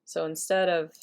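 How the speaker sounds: normal talking voice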